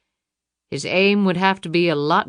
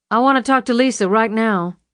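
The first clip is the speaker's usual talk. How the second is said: louder, firm, stress on want; firm demand; 5 semitones higher, 6 dB louder than usual. A narrator's reading. Luann demands Jackson put Lisa on, louder. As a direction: demand to speak to her daughter.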